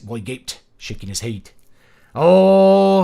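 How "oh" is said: a loud, high Scottish "Oh"; Scottish accent. Shown as a demonstration. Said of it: "replicate a Scottish accent".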